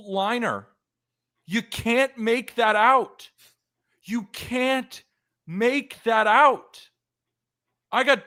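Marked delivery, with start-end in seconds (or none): 1.5-3.3 slow, higher disbelieving complaint; exasperated. Real, not acted. Host groaning at the final out on the bases, exasperated. 4.0-6.9 high, very slow, rising to loud on the last words; anguished disbelief. Real, not acted. Host repeating the baserunning blunder to cohost, anguished.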